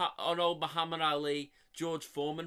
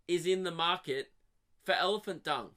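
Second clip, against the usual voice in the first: ordinary delivery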